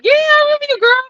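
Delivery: excited high greeting. Retold or imitated. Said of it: "And I was like".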